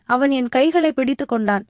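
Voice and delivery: ordinary delivery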